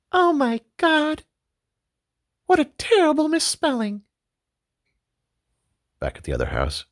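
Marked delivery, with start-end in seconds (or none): 0.1-4.0 high, astonished wife voice; amazed wife. Acted. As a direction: gasp at the discovery.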